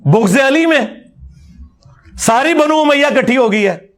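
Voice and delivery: mock fiery preacher pitched high, ringing out each phrase; mocking their preachers. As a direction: ham up an opponent cleric's grand line, sneering. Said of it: "ये भी इस तरह के जुमले बोलते हैं ना". (ऊँची आवाज़ में मौलवियों की नकल, तंज़ के साथ)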